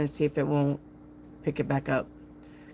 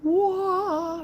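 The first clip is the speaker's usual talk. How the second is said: sweet voice pitched higher; high airy demo; 14 semitones higher than usual. Retold or imitated. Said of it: "it's just like".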